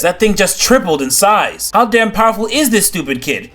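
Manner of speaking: high, strained alarm as it grows. Acted. Hawkman watching the parasite grow, alarmed and shouting.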